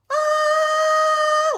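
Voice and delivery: high piercing scream of chaos; kids' scream. Retold or imitated. Mother recalling her kids at breakfast, a piercing scream. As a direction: shriek like squabbling kids at breakfast.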